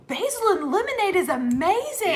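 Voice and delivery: high, loud imitation of his raving; hammed-up wonder at a discovery; amazed exclamation. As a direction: gush it, stretching up high as you mimic him. Acting out husband's amazed reaction.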